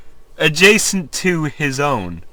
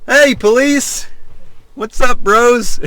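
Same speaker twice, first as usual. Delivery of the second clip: casual dude greeting; 7 semitones higher, 4 dB louder than usual. Retold or imitated. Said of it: "it's like".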